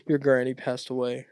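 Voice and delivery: ordinary talk, nothing marked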